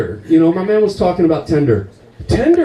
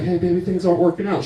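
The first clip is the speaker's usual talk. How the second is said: nervous quick breakup; 3 dB quieter, faster than usual. Acted.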